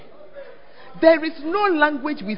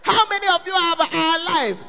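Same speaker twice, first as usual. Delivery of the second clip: retold shout; 6 semitones higher than usual. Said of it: "Paul was saying".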